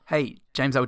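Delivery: ordinary talk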